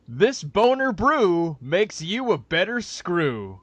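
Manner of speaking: slow, deliberate mock-ad read; slow spam-pitch reading; reading the subject line. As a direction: savor each word like a cheesy slogan. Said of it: "the subject line was".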